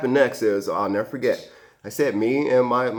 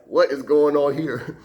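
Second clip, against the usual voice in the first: slow, louder, higher disbelief; alarmed bewilderment; 4 semitones higher, 5 dB louder, slower than usual. Retold or imitated. Firefighters reacting as the mom flees, bewildered alarm. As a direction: demand an answer, retold with stunned emphasis.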